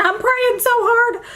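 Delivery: high-pitched, overcome with feeling; emotional squeak. Real, not acted. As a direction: voice shoots way up with emotion. Emotional retelling of friend's pregnancy news.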